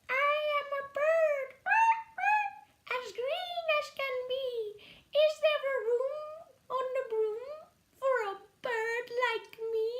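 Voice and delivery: high, fast chirpy bird voice; green bird. Acted. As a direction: twitter like an eager little bird. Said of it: "then said".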